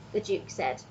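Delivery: usual speaking voice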